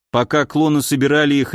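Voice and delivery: plain reading voice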